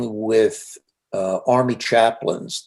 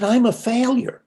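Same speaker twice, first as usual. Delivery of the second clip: upset outburst; 12 semitones higher, 3 dB louder than usual. Retold or imitated. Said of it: "She was pretty upset".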